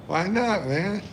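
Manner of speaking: surprised why not. Real, not acted. Reacting to the chat, surprised.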